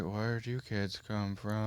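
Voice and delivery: slow, very quiet dazed voice; disoriented teacher. Retold or imitated. Gym teacher not recognising his own class, dazed and quiet. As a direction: drift it out softly, as if lost.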